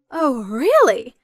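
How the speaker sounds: slow, drawn-out, disapproving oh really; displeased oh really. Acted. Mother hearing Samantha won't come home, disapproving. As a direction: let the disappointment drag.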